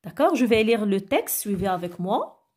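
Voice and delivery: ordinary talk, nothing marked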